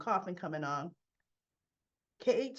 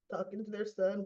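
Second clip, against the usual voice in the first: normal talking voice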